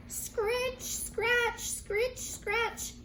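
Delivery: a hoe scratching the soil; hoe scraping sound. A narrator's reading. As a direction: scrape out each scritch and scratch. Hoe noise imitated.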